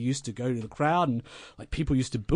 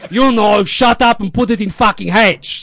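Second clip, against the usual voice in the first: loud, higher, slow yell; furious mentor in the passenger seat; impatient shout; 7 semitones higher, 10 dB louder than usual. Retold or imitated. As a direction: shout him down. Lou losing patience.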